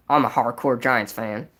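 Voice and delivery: low, self-important imitation; mock arrogant. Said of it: "Just look how arrogant he is".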